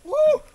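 sharp high gasp at icy water; cold shock gasp. Real, not acted. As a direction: gasp hard as the cold hits. Jumping into icy lake. (Kälteschock-Keuchen)

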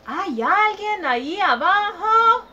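put-on child calling down a hole. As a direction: shout down into the dark hole. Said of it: "y gritó".

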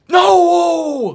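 real alarmed shout; dismayed shout. Real, not acted. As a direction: yell out in dismay. Quarterback run surprises him.

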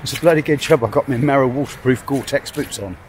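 ordinary talk

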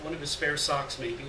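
ordinary delivery

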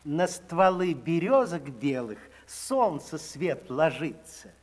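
ordinary delivery

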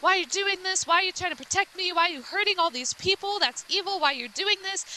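high, urgent acted confrontation; confronting. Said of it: "he's, like, confronting him".

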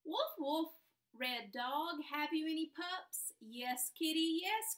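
slow, sing-song storybook read; reading to children. Acted. Teacher reads a rhyming story to her class, sing-song. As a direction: read it out slowly, like a picture book at snack time.

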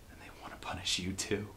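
fast, fearful whisper. Acted. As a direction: hiss a warning in dread. Dark forces now angered.

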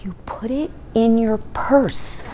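soft whispered tip-off; Paul Newman whispering. Retold or imitated. Paul Newman to a flustered fan, a whisper. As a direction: whisper it like a kind secret. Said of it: "looks at her and he says".

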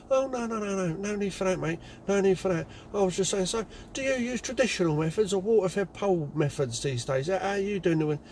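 high, flustered climb-down; backpedalling. Retold or imitated. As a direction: hastily back down. Caller backing down quickly.

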